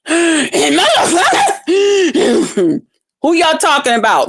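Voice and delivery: slow, wheezy gasping between words, hammed-up; mock out of breath. Mocking a woman who can't catch her breath, exaggerated panting. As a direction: pretend to heave for air as you talk. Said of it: "can't hardly catch her goddamn breath".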